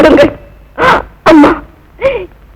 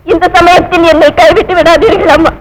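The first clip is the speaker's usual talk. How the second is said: ordinary talk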